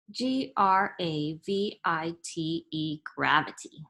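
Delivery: letter by letter, then the whole word gravity; spelling it out. Shown as a demonstration. Teacher to children, spelling out and saying gravity. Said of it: "spells the word out".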